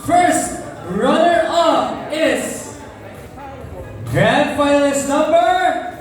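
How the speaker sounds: drawn-out announcement building suspense; pageant host. Acted. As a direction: stretch it out like a pageant emcee. Announcing first runner-up.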